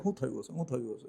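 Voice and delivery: hushed, worried thinking aloud; a soft, fretful inner whisper of concern; anxious inner voice. Retold or imitated. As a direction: barely voice the worry to yourself. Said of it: "અંદરથી એમ થાય".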